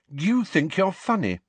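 high, cutting disdain; cold. A narrator's reading. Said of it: "she said coldly".

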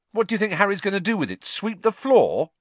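high, loud, incredulous sarcasm; scornful retort. Acted. Boy scoffing at girl's caution, scornful. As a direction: rush the question, then stretch the loud punchline. Ron scoffs at Hermione's warning.

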